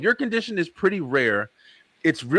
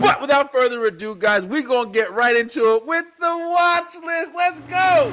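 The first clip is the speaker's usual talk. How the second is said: loud, high show-host hype; hype host intro; 11 semitones higher, 3 dB louder than usual. Acted. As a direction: pump up the crowd like a host. Host launching show segment.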